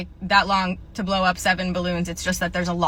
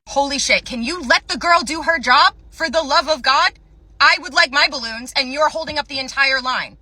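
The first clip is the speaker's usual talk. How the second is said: second Karen snapping; 5 semitones higher, 4 dB louder than usual. Acted. Second Karen intervenes loudly.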